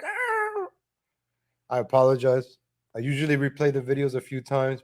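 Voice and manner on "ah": high ringing squeal, held out; imitated ear screech. Host showing viewers what the noise did to their ears, high squeal. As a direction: squeal it high, like overloaded headphones. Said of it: "my ears are like".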